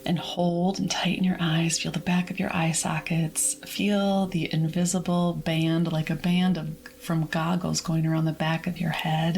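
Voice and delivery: calm, measured meditation voice; guided relaxation. Acted. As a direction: guide listeners gently through releasing tension, with long pauses. Leads whole body relaxation.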